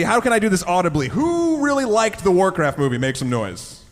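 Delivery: ordinary talk, nothing marked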